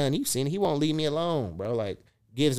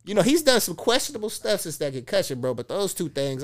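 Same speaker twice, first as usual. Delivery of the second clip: ordinary talk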